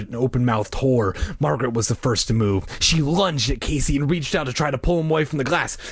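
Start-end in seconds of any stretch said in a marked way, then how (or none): none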